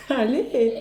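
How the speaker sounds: laughing through the word; giggly, trying to restart. Real, not acted. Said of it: "arrête de rire".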